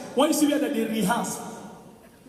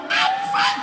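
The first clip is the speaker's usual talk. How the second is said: very high strangled squeak; squeaky girl voice. Retold or imitated. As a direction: squeeze it out tiny and high. Girl's voice squeezed by corset.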